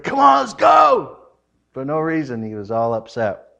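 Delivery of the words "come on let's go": imitated shout. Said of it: "He's like".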